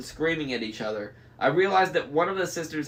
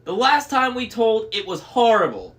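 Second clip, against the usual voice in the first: high, loud crone's lament; complaining hag; 7 semitones higher, 6 dB louder than usual. A narrator's reading. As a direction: wail the complaint in a raised voice. Sisters lamenting together.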